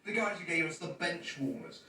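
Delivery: ordinary talk, nothing marked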